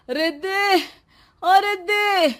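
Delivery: high, loud calling out across the house; husband calls anxiously to his wife from the roof; shouting for wife. Acted. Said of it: "excited होकर चिल्ला रहे हो".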